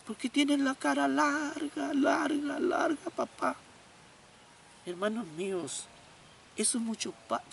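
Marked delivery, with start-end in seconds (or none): 0.0-3.5 put-on child voice. Boy explains the joke.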